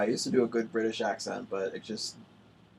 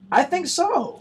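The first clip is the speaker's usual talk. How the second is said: mock certainty; 9 dB louder, slower than usual. Real, not acted.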